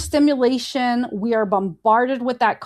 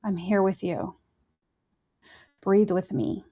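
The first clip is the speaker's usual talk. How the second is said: soft, quiet comfort to someone panicking; gently calm a friend in panic; quiet, steady reassurance; reassuring; 3 semitones lower, 5 dB quieter than usual. Retold or imitated. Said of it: "be like".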